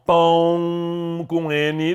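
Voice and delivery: "pão com N" said slowly, stretching "pão"; slow spelling. Shown as a demonstration. Teacher showing the spelling to students, slow. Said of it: "O N é uma consoante nasal".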